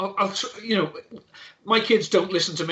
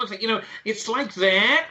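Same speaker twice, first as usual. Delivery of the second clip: put-on whiny California accent; 4 semitones higher than usual. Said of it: "quite a whiny California one".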